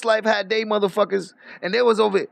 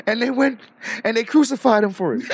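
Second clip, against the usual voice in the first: ordinary delivery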